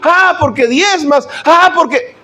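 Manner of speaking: sneering questions pitched high; mocking critic. Critic nags a young believer in a high mocking voice. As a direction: needle them with scorn.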